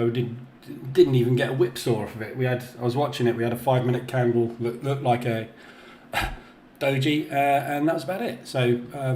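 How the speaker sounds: usual speaking voice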